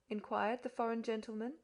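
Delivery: usual speaking voice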